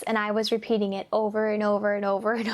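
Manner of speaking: ordinary talk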